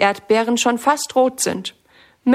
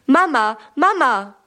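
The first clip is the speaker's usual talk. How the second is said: put-on a child calling urgently for mum, somewhat raised and higher; calling shout; 3 semitones higher than usual. A narrator's reading. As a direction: call out for Mama across the garden. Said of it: "schreit Sammy".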